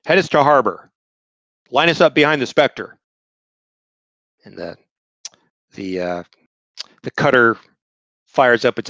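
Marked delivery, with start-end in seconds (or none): 0.0-3.0 raised, commanding captain's orders; ship captain commanding. Acted. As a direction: bark orders like a ship's captain. Captain giving orders to crew.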